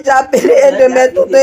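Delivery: ordinary talk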